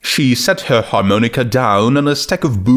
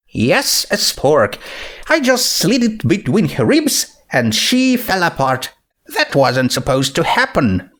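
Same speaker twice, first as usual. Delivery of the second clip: Twilight voice; 7 semitones higher than usual. Acted.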